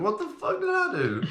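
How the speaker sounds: very high squeaky quote; comic high-pitched bewilderment; mock disbelief. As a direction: shoot the voice way up high. Acted quote, pitch leaps very high.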